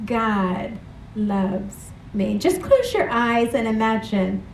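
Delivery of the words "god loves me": slow, soft God loves me, stress on God; softly affirmed. Shown as a demonstration. Preacher modelling the words for the congregation, slow and soft. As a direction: speak it slowly as a tender affirmation. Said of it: "and say".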